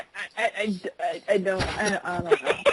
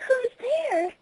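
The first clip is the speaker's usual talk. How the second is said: put-on high, timid pony girl; 3 dB quieter than usual. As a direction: call out high and unsure. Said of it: "she asked".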